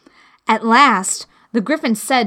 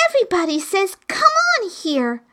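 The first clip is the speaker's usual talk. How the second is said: put-on high, curious girl's voice; little girl Alice; 11 semitones higher, faster than usual. Alice questioning the Griffin in a high girl's voice. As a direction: ask it as a puzzled young girl.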